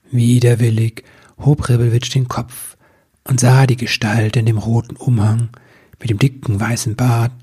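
plain reading voice

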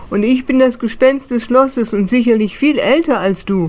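smug castle ghost boasting; boastful. Acted. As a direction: puff yourself up over the little one. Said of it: "prahlte sein Gegenüber".

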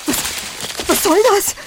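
panicked. Acted. Victim has noose put on.

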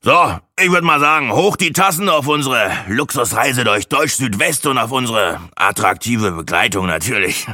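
high, loud opening, easing down as it goes; patronising toast. A narrator's reading. Braggart toasting the trip with new companions, patronising. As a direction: propose a toast like a generous big shot. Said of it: "gönnerhaft zu einem Toast an".

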